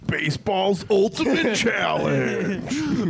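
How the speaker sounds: high, loud, slow hammed-up promo shout; hammed-up announcer; mock announcer. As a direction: boom it like a TV sports promo.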